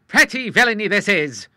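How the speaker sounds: a hammed-up old sailor's indignation; indignant cry. A narrator's reading. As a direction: bristle at the villainy. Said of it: "cried the Admiral".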